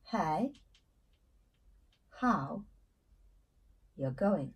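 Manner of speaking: Hey, how, you going spaced out slowly with long pauses; slow word by word. Shown as a demonstration. Teacher spells out the greeting for learners, slow word by word. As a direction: break the greeting into separate slow words. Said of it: "ça c'est une différence majeure".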